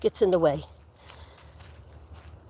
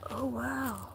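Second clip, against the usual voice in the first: hushed wow; 21 dB quieter, slower than usual. Real, not acted. Hushed awe in the woods.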